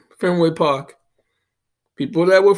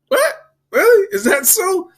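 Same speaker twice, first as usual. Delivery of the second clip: mock disbelief. Reacting to the mom claiming her son never talks like that, mock incredulous. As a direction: play it up like you can't believe your ears. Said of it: "I'm looking at her like".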